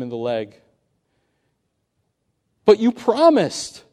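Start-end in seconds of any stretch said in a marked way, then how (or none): 2.7-3.8 higher, louder, slow betrayed protest; youth's anguished cry. Acted. Bitten youth crying out to the snake, betrayed. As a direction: cry out at the broken promise. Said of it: "cried the youth".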